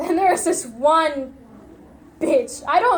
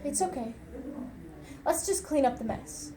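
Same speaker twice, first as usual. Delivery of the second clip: much softer, a hushed, even calm over seething rage; forced calm; 3 semitones lower, 13 dB quieter than usual. Retold or imitated. Killer soothing his raging mother, forced soft calm. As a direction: swallow the fury and say it gently. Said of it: "in the calmest voice I could muster".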